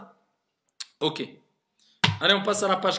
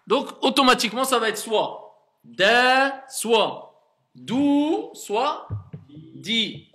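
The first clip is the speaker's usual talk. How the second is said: emphatic explaining; 7 semitones higher, 6 dB louder, slower than usual. Real, not acted.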